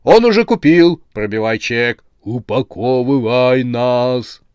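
loud start, drawn-out chanted finish; hasty change of tune. A narrator's reading. Threatened heads suddenly beg to be sold, urgently. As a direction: urge the sale through in a chorus. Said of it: "Головы резко поменяли своё мнение".

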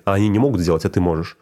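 ordinary talk, nothing marked

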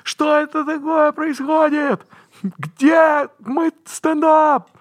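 high, slow baffled voice; confused audience. Retold or imitated. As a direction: whine like a puzzled spectator. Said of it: "которые".

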